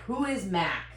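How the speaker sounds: ordinary talk, nothing marked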